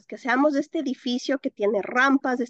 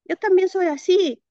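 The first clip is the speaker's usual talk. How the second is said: surprised realization; 7 semitones higher, 6 dB louder than usual. Retold or imitated.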